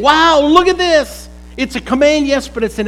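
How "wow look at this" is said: higher, louder, slow amazed Wow, look at this; amazed exclamation. Shown as a demonstration. Preacher acting out John's exclamation, amazed. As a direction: marvel at it out loud. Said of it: "he's saying".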